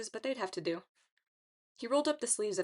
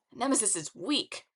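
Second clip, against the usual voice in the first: cocky taunt; 3 semitones higher than usual. A narrator's reading. Leo taunts before crowd.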